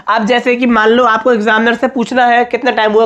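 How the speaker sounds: ordinary talk, nothing marked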